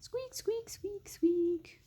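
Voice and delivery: high, whispered little squeaks; hamster squeaks. Pretend. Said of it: "imagining that she's having little squeaks".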